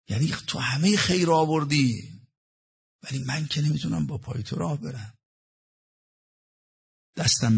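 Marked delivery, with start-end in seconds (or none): none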